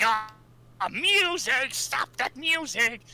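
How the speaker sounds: high, slow shrieking villain yell; Robotnik yelling. Retold or imitated. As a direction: shriek it like a furious cartoon villain. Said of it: "and he's like".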